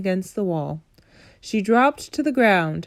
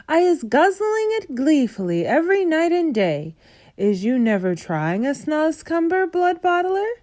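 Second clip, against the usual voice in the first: higher, coaxing giant voice; friendly giant coaxing; 8 semitones higher than usual. A narrator's reading. BFG coaxing the Bloodbottler to taste it, higher and eager. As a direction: tempt him to take a bite.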